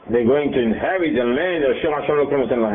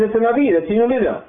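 sharply higher, offended exclamation; indignant protest; 8 semitones higher than usual. Acted. Teacher protesting the tribes' tone toward the new leader, high and offended. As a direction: protest that he is the chosen one.

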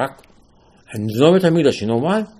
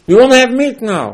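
demanding shout; 10 semitones higher, 9 dB louder than usual. Retold or imitated. People demanding meat from Moshe.

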